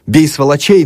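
high, loud jeering shout; kids' gleeful yell. A narrator's reading. Boys jeering from the street, high gleeful yell. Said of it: "надрывались радостно мальчишки".

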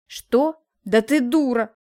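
loud, angry outburst; cursing. A narrator's reading. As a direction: explode at her in disbelief. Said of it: "выругался Алексей".

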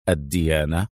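الديانة said low, as one item in a list; serious list item. A narrator's reading. Adham lists details he wants, الديانة low and serious. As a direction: say الديانة gravely, pitched well down. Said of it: "قال أدهم بجدية".

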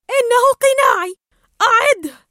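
high, loud possessive scream. Acted. Dory fights Marlin over the mask, screaming. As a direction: shriek to get it back. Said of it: "فصرخت دوري".

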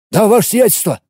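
eager. A narrator's reading. Officer snaps to attention.

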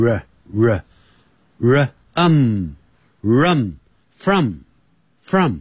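slow "ruh, um, rum, from"; phonics build-up. Shown as a demonstration. Teacher assembles the word for learners, slow sound-by-sound "from". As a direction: sound out "from" piece by piece, slowly. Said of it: "R就这边要念成".

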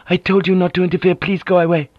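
plain reading voice

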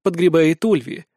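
usual speaking voice

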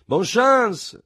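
café crowd shouting luck to the departing trio; shouted good wishes. Acted. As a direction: shout it after them. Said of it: "seguiti da grida di".

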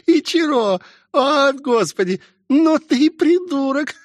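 very high, helpless laughter through words; girl in fits of mocking laughter; laughing hard. A narrator's reading. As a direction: cackle and gasp out the words. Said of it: "и, заржав, упала на колени".

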